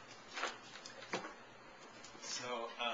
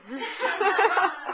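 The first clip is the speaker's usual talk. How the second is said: loud high laugh; 10 dB louder than usual. Real, not acted. Laughs after own line.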